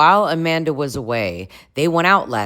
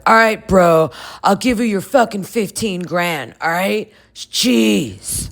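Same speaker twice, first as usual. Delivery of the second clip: higher-pitched mock exasperation; hammed-up, higher irritated bro voice; imagined Kyle retort; 6 semitones higher than usual. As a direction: play the put-upon bro giving in. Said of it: "if I can speak for him".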